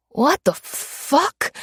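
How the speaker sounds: dazed disbelief. Acted. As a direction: stretch it out, stunned and lost. Confused mugger pinned down.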